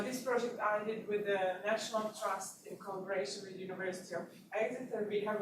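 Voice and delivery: ordinary delivery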